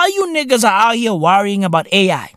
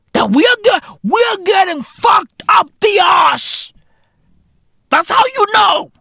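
ranting shout; 8 semitones higher than usual. Real, not acted. Comedian shouting the punchline to the audience. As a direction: yell the punchline. Rant peak.

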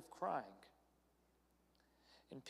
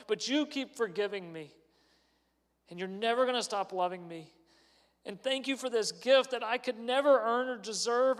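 rising, pleading prayer that swells louder; heartfelt prayer; 5 semitones higher, 7 dB louder than usual. Retold or imitated. Praying to God about his own failings, growing fervent and louder. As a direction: pour out thanks to God, building.

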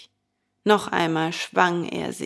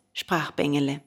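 ordinary delivery

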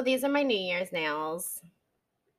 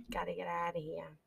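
ordinary talk

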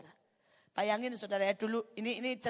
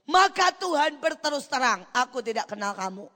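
loud, raised proclamation; verse declaimed forcefully; 3 semitones higher, 13 dB louder than usual. Acted. Preacher quoting a verse of warning, loud and stern. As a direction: thunder out God's rejection. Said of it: "Terus ada ayat".

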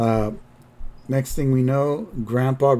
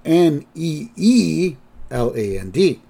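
slow spelling out; 3 semitones higher, 3 dB louder than usual. Shown as a demonstration. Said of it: "it's spelled".

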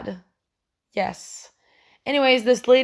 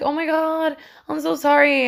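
higher-pitched voice of a sorry stranger; imitated sympathetic woman; 6 semitones higher than usual. Dog owner to the crying passenger, imitated sympathy.

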